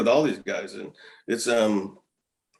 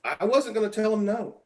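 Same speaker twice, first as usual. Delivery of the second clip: higher, put-on eager voice; mock eager reply; 9 semitones higher than usual. Ex-pitcher voicing his younger self accepting the call-up, higher and eager. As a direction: act out saying yes to the call. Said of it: "I'm like".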